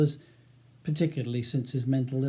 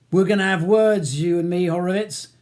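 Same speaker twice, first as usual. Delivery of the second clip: loud, menacing warning; threatening; 4 semitones higher, 7 dB louder than usual. A narrator's reading. Ronnie threatening Charles over the barrister's shoulder, menacing. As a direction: threaten him with a raised voice. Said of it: "points threatening at him".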